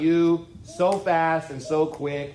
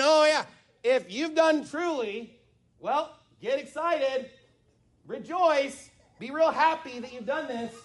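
sarcastic; 7 semitones higher than usual. Acted. Preacher paraphrasing Jotham's rebuke to the congregation, sarcastic. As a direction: stretch it out with fake approval, pausing between phrases. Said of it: "This is sarcasm, folks".

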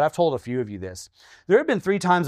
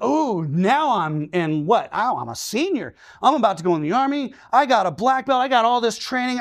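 high, excited self-pep talk; a teenager hyping himself up to step in, pitched high; psyched-up teen; 6 semitones higher than usual. Retold or imitated. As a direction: talk yourself into the fight, pumped.

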